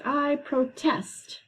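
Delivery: "protest" slowly, as pro-TEST, pitched high; stress on second syllable. Shown as a demonstration. Teacher showing the verb in use, "protest" stressed pro-TEST. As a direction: declare it with weight on the end of "protest": pro-TEST. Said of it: "The second one is protest".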